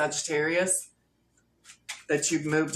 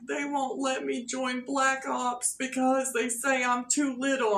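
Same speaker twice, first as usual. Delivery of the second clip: put-on crying little boy; 5 semitones higher than usual. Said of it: "so the little boy's crying".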